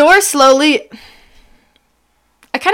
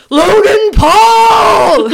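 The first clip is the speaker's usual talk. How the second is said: higher, much louder, slow furious roar; roared name; 10 semitones higher, 6 dB louder, much slower than usual. Acted. Jealous Drew roaring at his boyfriend, furious roar. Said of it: "he roared".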